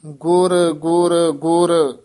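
three slow, even ਗੁਰ, then a stop; rest after third ਗੁਰ. Shown as a demonstration. Teacher moves the rest for the student, pause after third ਗੁਰ. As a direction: keep every ਗੁਰ in one group and rest after the last. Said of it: "ਇੱਥੇ ਵਿਸਰਾਮ".

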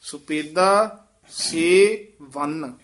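slow, with ਸੇ and ਵੰਨ said as two words; don't merge ਸੇ ਵੰਨ into one; ਸੁਪੇਦਾ, then ਸੇ, then ਵੰਨ; ਸੇ ਵੰਨ apart. Shown as a demonstration. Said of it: "ਸਿਵੰਨ ਇਕੱਠਾ ਨਹੀਂ ਕਹਿਣਾ".

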